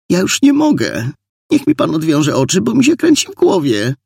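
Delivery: high, whining complaint; distressed pleading. A narrator's reading. Frightened woman pleads with her captor. (błagalnie, wysokim głosem)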